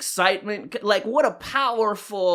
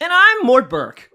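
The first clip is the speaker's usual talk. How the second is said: character voice; 9 semitones higher, 6 dB louder than usual. Acted. Host intro in character voice.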